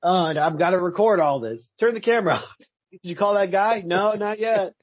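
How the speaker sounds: hammed-up irritated roommate on camera; mocking a movie scene's nagging; mock annoyed character. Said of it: "It's like".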